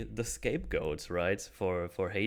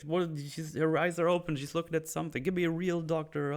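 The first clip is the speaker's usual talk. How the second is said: furious; 8 semitones higher than usual. Retold or imitated. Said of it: "like, furious".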